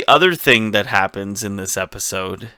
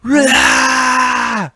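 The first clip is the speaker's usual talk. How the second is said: high-pitched, drawn-out comic yell; mock yelling; 11 semitones higher, much slower than usual. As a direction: wail it high, ending in a long mock scream. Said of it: "'cause I'm yelling a bit".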